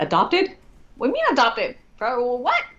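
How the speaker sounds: high, incredulous echo of the word; shocked disbelief. Retold or imitated. Client told of adoption, high shocked disbelief.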